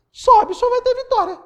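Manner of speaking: high, sing-song sarcastic promise; ironic. Acted. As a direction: toss off the victory promise mockingly. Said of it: "Só que vai ser irônico da minha parte".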